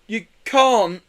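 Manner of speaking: whining. A narrator's reading. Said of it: "they whined".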